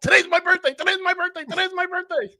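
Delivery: put-on high, excited little-kid chant; five-year-old voice. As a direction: bounce it out like an excited child. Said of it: "Like a five-year-old".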